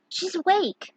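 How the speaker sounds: put-on young girl voice. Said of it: "the girl said".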